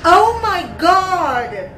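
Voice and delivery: loud, slow, stunned oh my God; shocked. Real, not acted. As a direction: drag it out in disbelief. Shocked by plot twist.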